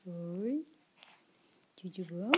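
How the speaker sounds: normal talking voice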